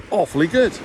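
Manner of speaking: ordinary delivery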